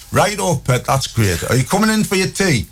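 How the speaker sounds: a mum who only cares if he's home for tea; mum's voice. Retold or imitated.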